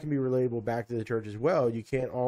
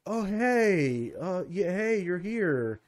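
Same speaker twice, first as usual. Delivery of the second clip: high, drawn-out hesitant hello; awkward surprised greeting; 7 semitones higher, much slower than usual. Acted. Churchgoer bumps into the pastor at a local store, awkward surprise. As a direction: greet them haltingly, voice lifted in awkward surprise.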